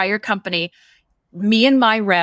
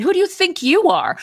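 retold angry comeback; 9 semitones higher than usual. Snapping back at the manager, indignant higher echo. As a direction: fire his question straight back at him. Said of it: "And I said".

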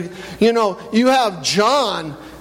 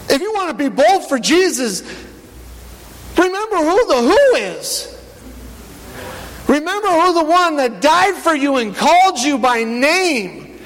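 rising sermon preaching; 6 semitones higher than usual. Acted. Pastor fires up the church about Peter's boldness, preaching with mounting fervor. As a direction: build the sermon up to a passionate peak. Preaching builds to full force.

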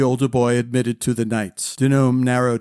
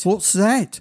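higher-pitched wondering question; curious; 6 semitones higher than usual. A narrator's reading. Malady asking about the glow, curious. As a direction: ask with a lift of curiosity.

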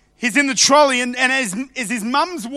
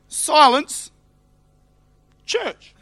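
higher, louder mock order; preacher mocking the church sign; mock stern command; 5 semitones higher, 4 dB louder, much slower than usual. Shown as a demonstration. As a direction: ham up the strict sign. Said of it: "this is what it says".

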